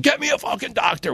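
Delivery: retold yell at contained volume; Rob Weiss yelling. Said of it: "Rob Weiss was yelling".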